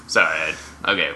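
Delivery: ordinary talk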